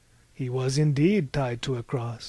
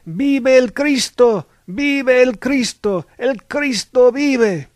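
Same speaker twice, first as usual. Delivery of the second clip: loud, high drawn-out cry; 10 semitones higher, 12 dB louder, slower than usual. A narrator's reading. A voice in the crowd proclaims Christ lives, crying out. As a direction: shout it slowly over a whole crowd, pitched high. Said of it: "a voice cried out".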